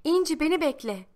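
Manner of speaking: put-on girl running, calling to İnci; child calling out. A narrator's reading. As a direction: shout her name while running. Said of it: "Bağırıyorum bir yandan da".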